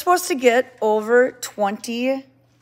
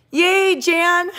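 loud, high yay for a fellow Jan; excited cheer; 8 semitones higher, 7 dB louder than usual. Real, not acted. Cheering a fellow Jan in the chat, loud and high. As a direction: cheer her name out gladly. Cheering a viewer sharing her name.

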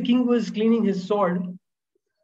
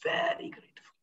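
breathy whispered emphasis; 3 semitones lower, 10 dB quieter than usual. Real, not acted. Speaker whispering to host about gratitude, hushed. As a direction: whisper it like a secret.

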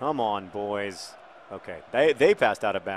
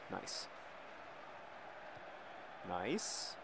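ordinary talk

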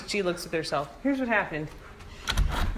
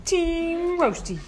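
higher and drawn out; sing-song call of the team; high slow team name; 6 semitones higher, much slower than usual. Real, not acted. Owner handing out carrots, calling the team high and slow. Naming treat teams for the dogs.

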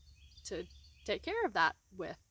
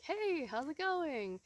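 higher, slow, bright hello to a stranger; cheery greeting; 7 semitones higher, slower than usual. Retold or imitated. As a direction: greet the odd guy cheerfully.

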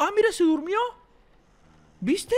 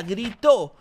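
ordinary talk

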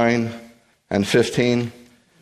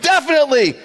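high, loud eager yell; eager shout; 5 dB louder than usual. Retold or imitated. One man in the crowd yelling eagerly, high and loud. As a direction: shout it with total confidence. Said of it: "this one guy's like".

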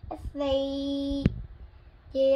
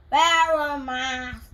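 put-on slow, louder kid voice; little boy; 7 dB louder, slower than usual. Little Max suggests a mask, slow and louder. Max's voice again.

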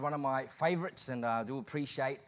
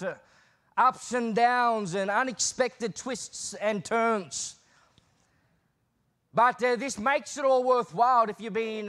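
rising preacher's build, louder and higher; preaching; 6 semitones higher, 6 dB louder than usual. Acted. Visiting pastor preaching to the congregation, rising fervour. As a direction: preach it to the church with full heart.

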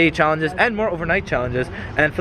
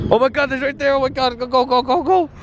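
panicked urging; 9 semitones higher than usual. Real, not acted. Harbor patrol spotted, fleeing.

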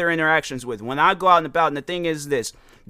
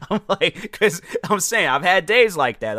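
laughing; 4 semitones higher than usual. Real, not acted. Laughs at own story.

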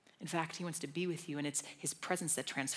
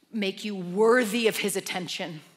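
louder, fervent preaching, slowing on key lines; impassioned preaching; 5 semitones higher, 15 dB louder than usual. Real, not acted. Preacher urges congregation to pray continually, fervent and loud. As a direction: preach it out with full conviction.

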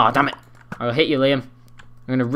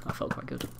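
normal talking voice

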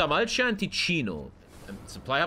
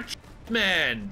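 ordinary talk, nothing marked

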